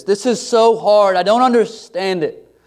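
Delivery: pained lament. Acted. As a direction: cry out in frustration at hardship.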